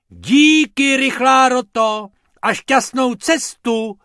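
very loud, high grateful shout; shouting thanks. Acted. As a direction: yell your thanks across the sand. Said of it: "křikl pan Pumpernagel".

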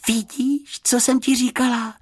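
put-on very high, gleeful girl; rejoicing. A narrator's reading. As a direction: crow happily in a light high voice. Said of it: "zaradovala se Juditka".

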